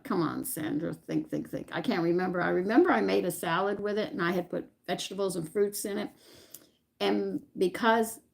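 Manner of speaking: usual speaking voice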